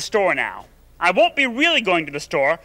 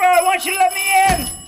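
higher and louder demand; shouting the demand at the door; angry yell; 10 semitones higher, 4 dB louder than usual. Pretend. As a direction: bark it out, fed up. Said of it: "I'm losing my temper".

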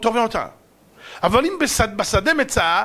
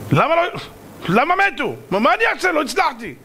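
high, stammering defensive cry; acted flustered self-defense; exasperated excuse; 8 semitones higher than usual. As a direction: throw up a helpless, agitated protest. Doctor protests he could not succeed.

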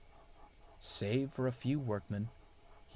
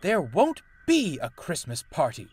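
loud, raised, cross retort; snapping; 6 semitones higher, 7 dB louder than usual. A narrator's reading. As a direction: snap it back, louder and higher. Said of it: "he snapped".

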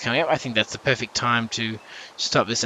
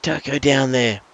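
mock yell, pitched up high; higher, mildly raised imitation of shouting; imitated yelling viewers; 6 semitones higher, 4 dB louder than usual. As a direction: yell like an exasperated viewer at the screen. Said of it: "yelling at your screen like".

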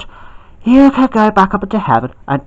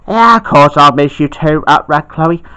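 normal talking voice

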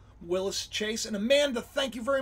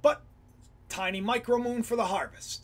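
usual speaking voice

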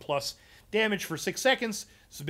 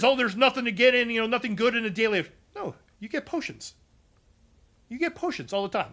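Zyno impression; 6 semitones higher than usual. Streamer showing chat his Zyno, high and lively. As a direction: do a light Zyno, pitched up and bright-spirited. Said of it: "That's my Zydone".

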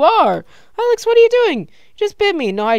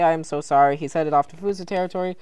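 ordinary talk, nothing marked

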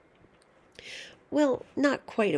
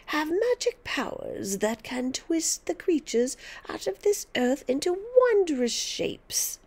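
put-on high, rising, menacing old witch; throaty witch voice; 7 semitones higher than usual. Grandma frightening George with her magic, high witchy menace. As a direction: boast of dark magic to scare him. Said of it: "whispering in a throaty sort of voice".

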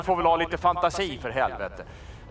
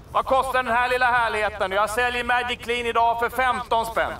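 loud, fast price call; sales pitch; 4 semitones higher, 3 dB louder than usual. Acted. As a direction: pitch the bargain to the crowd. Price announcement.

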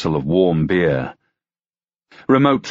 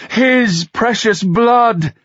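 high, loud, slow rapturous wail; ecstatic cry; 4 dB louder, much slower than usual. Acted. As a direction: draw it out in rapture. Girl ecstatic over raindrop.

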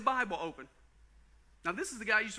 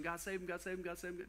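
soft, mumbled quick prayer; hushed repeated prayer; quiet rote praying; 8 dB quieter than usual. Retold or imitated. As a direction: murmur it like a list. Rote prayer through his list.

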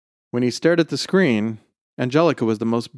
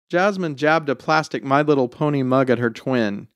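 ordinary delivery

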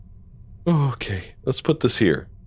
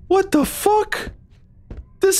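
stunned, high-pitched disbelief; alarmed disbelief. Real, not acted. Startled by the game's scene.

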